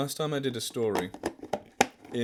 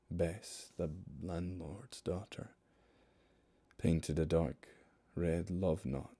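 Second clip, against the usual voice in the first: hushed storyteller reading a ballad; poem recital; 4 semitones lower, 4 dB quieter than usual. Acted. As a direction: read it like a haunting ballad. Reads The Highwayman poem aloud.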